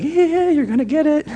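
high, mocking gloat; gleeful gloating. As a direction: taunt them with glee. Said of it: "We don't say it gleefully like".

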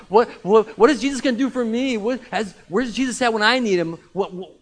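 loud, high, stumbling outburst; agitated, stammering cry from the roadside; acted awkward questions. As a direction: blurt out demanding questions as a blind beggar. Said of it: "they start to ask awkward questions like".

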